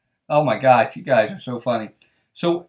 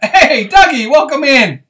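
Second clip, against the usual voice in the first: laughing greeting; 10 semitones higher, 8 dB louder, slower than usual. Real, not acted. Laughing welcome to a live viewer.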